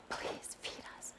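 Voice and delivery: slow, very soft whispered plea; whispered mock begging. God mimicking her begging man for money, in a whisper. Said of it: "begging man for money".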